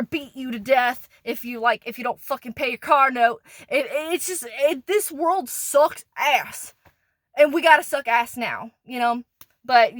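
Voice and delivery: usual speaking voice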